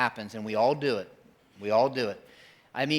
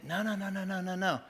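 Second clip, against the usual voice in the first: a soft, higher calming no; gentle divine no; 6 semitones higher, 6 dB quieter than usual. Retold or imitated. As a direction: soothe with a hushing no. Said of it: "He's like".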